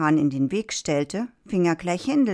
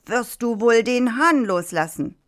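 raised, demanding call; 6 semitones higher, 4 dB louder than usual. A narrator's reading. Farmer pursuing the fox demands the rooster, calling out. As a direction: call it out higher, as an order. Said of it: "verfolgte ihn weiter und rief".

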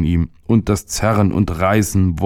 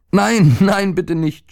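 put-on very high panicked boy's plea; crying out; 12 semitones higher, slower than usual. A narrator's reading. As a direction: beg in a high, alarmed child's voice. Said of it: "schrie der Junge". (hoher, ängstlicher Aufschrei eines Jungen)

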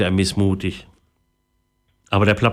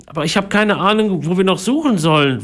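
put-on boy's voice; 9 semitones higher than usual. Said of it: "wollte Philipp gerade sagen".

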